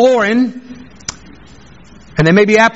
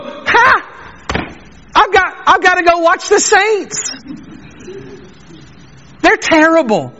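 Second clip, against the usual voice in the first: higher, laughing brush-off; careless scoff; 5 semitones higher than usual. Pretend. Hard-hearted listener shrugging off the sermon, higher and laughing. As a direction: laugh it off, mind on the football game.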